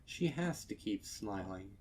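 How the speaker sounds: ordinary delivery, nothing marked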